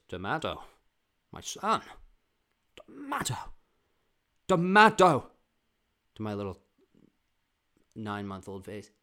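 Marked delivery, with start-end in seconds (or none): 0.1-5.3 hammed-up dad, rising to high and loud; insistent father. Father drilling his baby son on tomato, hammed-up insistence. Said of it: "And he goes".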